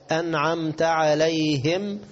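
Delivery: slow "أنعمت" ending in ta; correct fatha. Shown as a demonstration. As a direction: recite "أنعمت" as an'amta, drawn out. Correct recitation after mistakes. (ترتيل «أنعمت» بفتح التاء)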